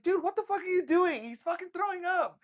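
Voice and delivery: high, sharp scolding; yelling at the friend in anger; angry scolding. Retold or imitated. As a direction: tell him off hard.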